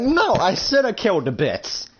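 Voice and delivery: high-pitched angry retort; heated refusal. Acted. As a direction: refuse to be calmed, still raging. Refusing to calm down.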